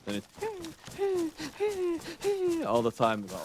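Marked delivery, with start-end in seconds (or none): 0.3-2.6 repeated very high whines; imitated dog crying. Owner mimicking his dog crying for a walk, high whining. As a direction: whimper insistently like a dog. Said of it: "the louder his cries got".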